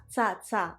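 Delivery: tsa said as a crisp ts plus a; tsa sound. Shown as a demonstration. Teacher repeating the ending sound, crisp tsa. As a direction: snap out tsa, tsa. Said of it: "on le prononce tsa".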